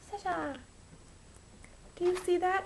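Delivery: high, soft cooing to a pet; high pet voice for the cat. Real, not acted. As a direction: coo at the cat gently. Talking to her cat.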